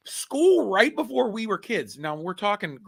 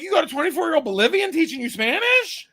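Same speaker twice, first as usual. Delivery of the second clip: higher, laughing disbelief; incredulous laughing; 10 semitones higher, 4 dB louder than usual. Retold or imitated. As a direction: laugh it out in mock outrage. Retelling his reaction to his kids.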